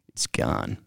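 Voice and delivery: low grave whisper of bad news; deep hushed Steve. Imitating Steve announcing the money is lost, deep and hushed.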